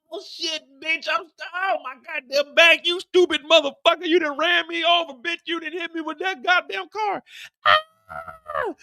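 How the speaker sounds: high strained yelling; high hammed pained cries; pained cursing. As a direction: curse through the pain. Hitman run over.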